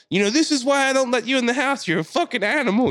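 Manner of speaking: high-pitched exasperated yell; imitated screaming back. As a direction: imitate a fed-up man yelling down at her. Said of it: "He's screaming at her".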